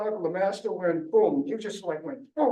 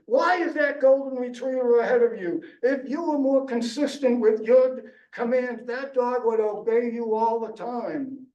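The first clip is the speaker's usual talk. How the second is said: high, fast mock bark of a drill trainer; hammed-up scolding, raised and rushed; mock shouting trainer; 6 semitones higher than usual. Police dog trainer scolding owner, mock shout. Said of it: "stomped over again and shouted".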